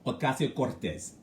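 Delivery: Alexandria Ocasio-Cortez with open Spanish vowels and trilled R; teacher saying Alexandria Ocasio-Cortez with Spanish sounds; Spanish pronunciation. Shown as a demonstration. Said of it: "Everyone say it now".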